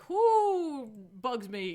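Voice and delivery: slow, loud mock dragon voice. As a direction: drag it out like a woken dragon. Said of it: "Dracul Carfang's like".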